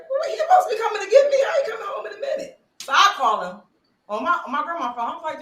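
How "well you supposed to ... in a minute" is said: high, fast, half-laughing disbelief; incredulous retort. Retold or imitated. To Javon on the phone, high quick laughing disbelief. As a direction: fire it back high and quick, amused. She questions him, laughing.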